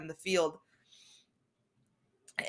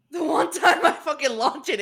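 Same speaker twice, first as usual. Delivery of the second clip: ordinary talk, nothing marked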